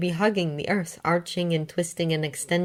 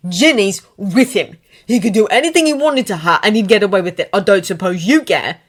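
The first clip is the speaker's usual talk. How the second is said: controlled anger; 2 semitones higher, 8 dB louder than usual. Acted. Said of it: "His voice was controlled".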